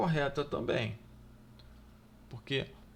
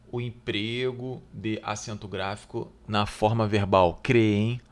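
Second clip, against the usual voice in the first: louder, deliberate read of the question; reading the exam item; 5 dB louder than usual. Acted. Teacher reading the exam question aloud to students, deliberately. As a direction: read the item out clearly to the class.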